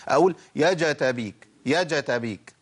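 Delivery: جتابيك with a full open fatha after the jeem; wrong fatha on jeem. Shown as a demonstration. Teacher demonstrating a common error, mildly exaggerated. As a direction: show the mistake by saying يا جتابيك with an added vowel. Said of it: "ما ينفعش".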